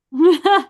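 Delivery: a quick burst of laughter; short laugh. Real, not acted. Laughs after getting heated.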